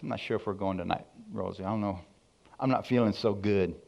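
low, soft excuse-making; feigned feeling poorly. Retold or imitated. Feigns illness to skip church.

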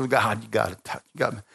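ordinary delivery

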